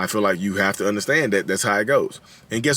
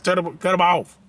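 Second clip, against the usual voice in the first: [name]; 4 semitones higher than usual. Retold or imitated. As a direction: belt it like a jokey soul crooner. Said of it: "What the [name]?".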